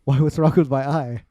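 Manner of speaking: mildly baffled retold reply; imitating oblivious friend. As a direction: ask back as if clueless. Friend answers, unaware of his eye.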